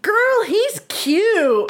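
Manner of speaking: put-on high, slow, sing-song sassy girl voice; mock sassy friend. Imitating the pushy guy cooing at her on the date. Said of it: "worked his little fucking way over".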